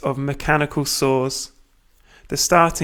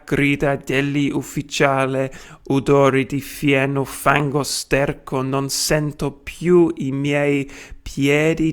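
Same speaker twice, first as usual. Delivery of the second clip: put-on Italian read in a broad British accent. Lecturer reading Marinetti in Italian for students, British accent. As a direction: read the Italian like a hungry Brit. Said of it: "in my British accent".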